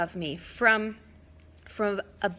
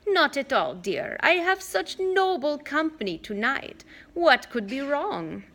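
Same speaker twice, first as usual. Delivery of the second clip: put-on raised, sugary old lady voice slowing at the end; sweet old lady; 7 semitones higher than usual. Old aunt reassuring the worried kids, sugary. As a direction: purr innocence like a sly grandmother.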